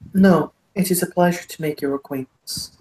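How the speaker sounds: courtly Irish lord, put on thick; broad Irish accent, politely formal; thickest Irish accent. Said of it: "the thickest Irish accent".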